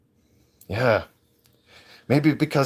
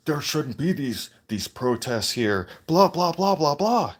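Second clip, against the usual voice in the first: mocking whiners; 4 semitones higher, slower than usual.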